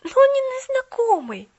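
high, loud protest; girl protesting, high and loud. Acted. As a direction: object eagerly. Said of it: "возразила Полианна".

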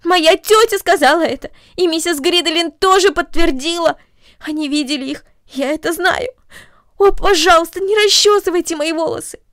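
high, loud, distressed protest; frightened girl pleading with the mother; anxious outcry. Acted. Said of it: "воскликнула Корнели в сильном беспокойстве".

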